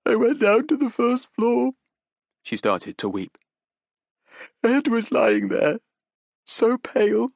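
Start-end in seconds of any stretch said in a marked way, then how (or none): 0.1-1.7 higher, shaky with tears; weeping young woman. Acted. Young woman confesses to detectives, close to weeping. 4.6-7.3 higher, shaky with tears; weeping young woman. Acted. Young woman confesses to detectives, close to weeping.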